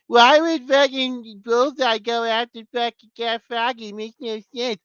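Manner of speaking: slow, whiny imitation of complaining trolls; whiny mocking voice. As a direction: mimic a whining fan, high and slow. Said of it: "All these bitches whining about".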